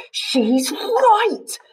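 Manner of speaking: high, loud and bold; shouted. A narrator's reading. Ella answering Norman, high and bold shout. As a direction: shout it, not frightened of anyone. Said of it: "Ella shouted".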